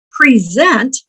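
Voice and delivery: "Present" stressed on the second part, pre-ZENT. Shown as a demonstration. Teacher showing the verb, "Present" as pre-ZENT. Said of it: "let me just pronounce these differently".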